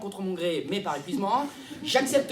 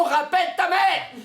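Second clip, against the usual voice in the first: loud, high, drawn-out yell; explode, stretching out each word; furious order; 8 semitones higher, 5 dB louder than usual. Acted. Fiancée explodes at the narrator. (cri exaspéré)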